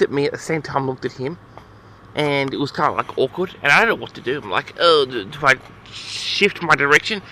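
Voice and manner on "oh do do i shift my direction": ordinary talk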